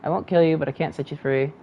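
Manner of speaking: ordinary delivery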